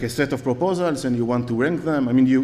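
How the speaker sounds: normal talking voice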